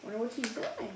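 lively, sing-song storybook reading; reading to children. A narrator's reading. Reading a rhyming picture book to kids, animated. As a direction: read it like bedtime for little ones.

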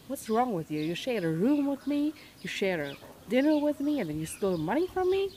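loud, high, furious shouting; furious yelling. Real, not acted. Robbed pilgrim yelling at the roommate thief, real fury. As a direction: yell at the thief who betrayed your trust.

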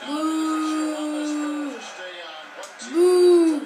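heckler's sustained boo. Real, not acted. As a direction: boo the play like a heckling fan. Booing the game.

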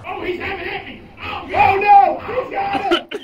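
excited shouting. Real, not acted. Chased by creature.

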